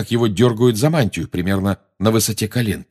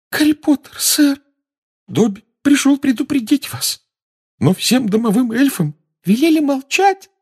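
squeaky elf voice. Acted. Dobby the elf warning Harry.

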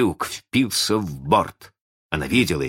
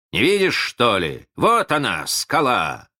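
выше обычного, с досадой; ткни товарища носом в очевидное; раздражённый окрик; 6 semitones higher, 3 dB louder than usual. A narrator's reading. Боцман сердито кричит товарищу. (раздражённый окрик боцмана)

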